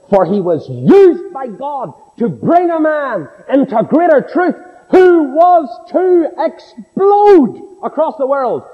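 rising preaching climax. Acted. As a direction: carry them up to the word explode. Preacher's climax on Paul's impact.

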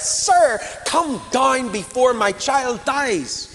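high, loud, slow urgent begging; frustrated plea. Acted. Father begging Jesus to come, high frustrated plea. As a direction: plead with every word. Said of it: "hear the frustration of the man".

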